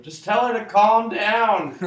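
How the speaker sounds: loud laughing yell across a room. Acted. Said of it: "I wanna yell from across the room".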